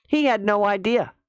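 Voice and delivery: normal talking voice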